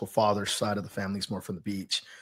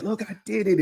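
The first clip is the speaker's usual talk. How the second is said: lightly voiced kid, much higher pitch; imitating proud kid; 10 semitones higher than usual. Kid showing the coach what they accomplished, imitated proud high voice. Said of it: "first thing they do is".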